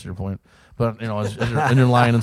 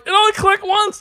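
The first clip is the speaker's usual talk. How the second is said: higher and louder, panicked repeat; rising panic; 7 dB louder than usual. Retold or imitated. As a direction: squeal it out in mock terror. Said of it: "I'm like".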